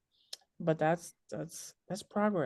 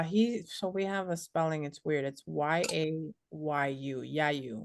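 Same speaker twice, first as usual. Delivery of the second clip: usual speaking voice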